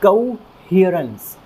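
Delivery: कोहेरेंस drawn out slowly, each sound clear; slow model word. Shown as a demonstration. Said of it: "एक बार फिर से उच्चारण करते हैं".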